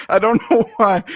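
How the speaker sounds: ordinary talk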